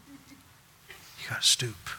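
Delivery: soft slow hush. Acted. Preacher to congregation, hushed and slow. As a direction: draw it out quietly, almost breathing it.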